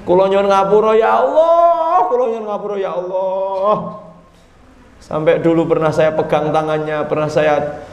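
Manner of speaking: chanting plea for forgiveness, long drawn vowels; mock penitent wailing to God; acted repentance. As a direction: beg in a stretched, hammed-up prayer tone. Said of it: "Eh, tobat".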